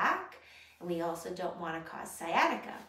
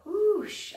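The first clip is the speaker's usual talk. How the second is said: loud high whoosh of breath; whoosh exhale; 13 semitones higher, 8 dB louder than usual. Shown as a demonstration. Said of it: "big exhale sound".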